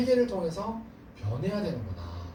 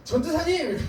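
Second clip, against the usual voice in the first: calling out; 9 semitones higher than usual. Retold or imitated. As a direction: yell his teacher's title to wake him. Boy calling out at night.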